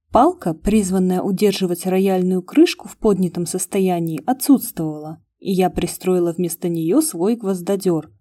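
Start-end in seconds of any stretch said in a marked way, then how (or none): none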